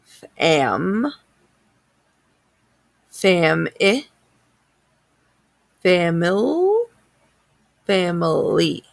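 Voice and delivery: family built up: Fam, fam-i, famil-, family; sounding out words. Shown as a demonstration. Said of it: "let's sound that out".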